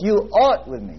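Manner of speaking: "art" punched and held above the rest; stress on art. Shown as a demonstration. Preacher moving to the next word, stress on "art". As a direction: lean on "art", then fall away on "with me". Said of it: "Emphasize every word".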